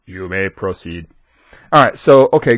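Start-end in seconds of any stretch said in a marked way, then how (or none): 0.1-1.1 flat robot voice. Shown as a demonstration. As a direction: speak it like a flat machine. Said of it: "I have to have a robot voice".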